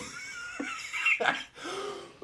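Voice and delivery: shrieking burst of laughter; a high laugh breaking out; high squealing laugh. Real, not acted. Cracks up at the memory.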